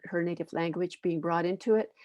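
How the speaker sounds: usual speaking voice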